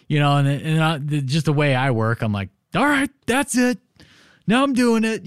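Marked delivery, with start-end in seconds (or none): 2.7-5.3 much higher, loud fired-up declaration; a mock vow to prove her wrong; defiant resolve. Speaker to wife, accepting her challenge, with fired-up defiance.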